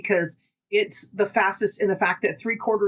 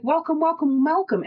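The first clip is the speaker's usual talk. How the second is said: mock welcoming; 8 semitones higher than usual. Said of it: "People are like".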